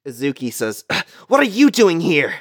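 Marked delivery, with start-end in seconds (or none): 1.3-2.3 high-pitched surprised demand; acted indignant surprise; startled indignation. Villain surprised by newcomer.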